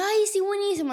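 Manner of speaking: agudo y emocionado, bajando el volumen; la niña que se alegra de la noticia; niña ilusionada. Retold or imitated. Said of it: "Y yo". (niña ilusionada, voz muy aguda)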